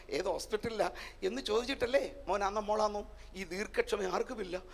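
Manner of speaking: normal talking voice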